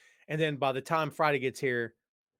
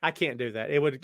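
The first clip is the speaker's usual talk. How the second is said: ordinary talk, nothing marked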